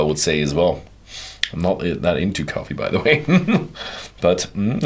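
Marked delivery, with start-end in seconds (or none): 3.3-4.2 short laugh. Real, not acted. Laughs at own coffee remark.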